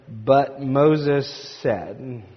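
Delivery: drawn out very slowly, word by word; dramatic slow emphasis. Retold or imitated. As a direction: stretch it out for suspense. Dramatic quote of the text.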